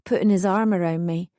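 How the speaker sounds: ordinary delivery, nothing marked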